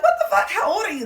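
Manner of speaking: very high, loud disbelief; shrieked how-old question; squealing shock. Retold or imitated. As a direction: screech it in amazement. Same girls, repeated shock.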